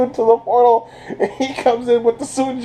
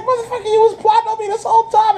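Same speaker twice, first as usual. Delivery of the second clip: much higher, fast, outraged; angry Nimbus; 12 semitones higher, faster than usual. Retold or imitated. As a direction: rattle it off fast in a high, betrayed voice. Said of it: "Nimbus is like".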